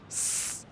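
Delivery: "S" as a held hiss; S sound. Shown as a demonstration. Teacher showing S at word start, sustained hiss. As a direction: say "sss" for "S", voiceless, no letter name. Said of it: "on le prononce".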